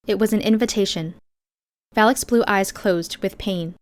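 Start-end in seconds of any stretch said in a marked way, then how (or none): none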